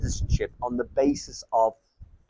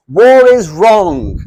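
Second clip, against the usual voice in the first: loud, drawn-out American activist slogan; American Spart accent; 6 semitones higher, 10 dB louder, much slower than usual. Retold or imitated. Lecturer mimicking far-left tankies' slogans, mock American accent. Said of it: "doing the American Spart accent".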